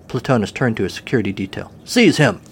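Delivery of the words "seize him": loud, high shouted order; shouting. A narrator's reading. Guard captain to his security detail, shouting. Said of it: "he shouted".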